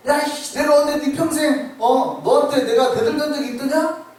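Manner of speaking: loud, high, exasperated talking donkey; fed-up donkey. Acted. Preacher voicing Balaam's angry donkey, loud and high. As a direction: snap back like a fed-up donkey who finally speaks. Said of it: "나중에 열받아서 말을 한단 말이에요".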